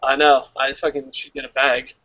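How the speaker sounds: usual speaking voice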